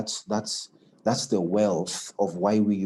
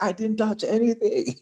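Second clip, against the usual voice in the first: retold defensive squeal, far higher; high-pitched protest; 4 dB louder than usual. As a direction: protest your innocence in a mock high voice. Retold defensive protest to his boss.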